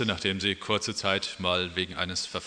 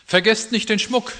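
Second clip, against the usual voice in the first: high, croaking magpie cry; 11 semitones higher, 5 dB louder than usual. A narrator's reading. The magpie reminds the other animals, high and croaking. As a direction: squawk it out like a magpie. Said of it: "krächzte die Elster".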